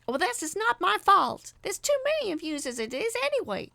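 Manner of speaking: high, loud, fast quarrelsome woman; shrill it out in a row; voicing one of the arguing women; shouting woman. A narrator's reading. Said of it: "I heard one voice say".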